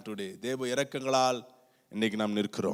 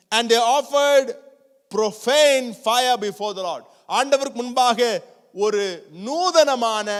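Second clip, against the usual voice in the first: forceful preaching; 10 semitones higher, 8 dB louder than usual. Acted. Retells the judgment with force.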